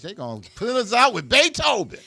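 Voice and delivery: cracking up at the idea; laughing. Real, not acted. Host joking about the closing Beethoven song, laughing loudly.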